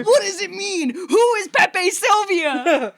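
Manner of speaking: high, slow, manic conspiracy yell; frantic conspiracy rant. Pretend. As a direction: rant like an unhinged conspiracy theorist.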